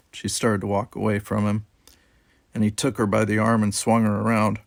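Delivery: usual speaking voice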